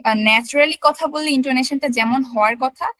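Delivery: ordinary talk, nothing marked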